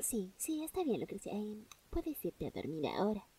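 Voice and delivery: soft, hushed soothing voice. Pretend. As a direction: coax her gently to sleep.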